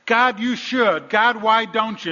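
high, loud, drawn-out challenge; loud mock demand. Preacher mimics people instructing God, loud mock demand. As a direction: call it out slowly at full force.

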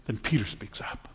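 whispered, unvoiced narration; hushed whisper. A narrator's reading. Pastor whispering to the congregation before Peter speaks. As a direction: drop to a whisper to build suspense.

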